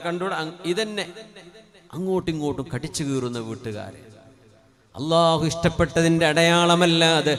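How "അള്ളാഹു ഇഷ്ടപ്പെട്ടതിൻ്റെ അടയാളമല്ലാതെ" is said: loud, sing-song chanted sermon; preaching at full force. Acted. Preacher exhorting the congregation about Allah's sign, loud chanted preaching. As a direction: declaim it to the whole mosque.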